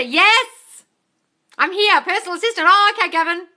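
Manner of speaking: high, loud cheery hellos; excited greeting. Real, not acted. Host greeting her live viewers: high, loud excited. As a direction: greet the viewers with a burst of energy.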